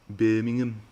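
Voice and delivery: exaggerated Birmingham accent after a laugh; stronger Birmingham accent. Shown as a demonstration. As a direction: have another go at Brummie, pushing it harder. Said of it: "That was a bad Birmingham accent".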